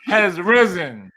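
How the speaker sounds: slow, high, grand announcement; mock-royal proclamation. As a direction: proclaim it grandly, drawing out the end.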